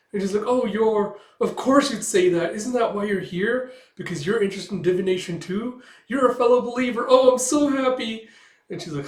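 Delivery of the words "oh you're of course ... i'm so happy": much higher and louder, gushing; overjoyed at a fellow believer, slowing on happy; squealing delighted lady; thrilled Melinda. Acted.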